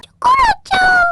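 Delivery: high worried call for a lost friend. Acted. Cream calling out for her missing pet. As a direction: call the name out high and anxious.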